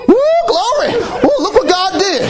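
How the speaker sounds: high, whooping joyful shout; excited praise. Retold or imitated. Preacher acting the Israelites praising after the sea, whooping. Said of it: "now they praising God".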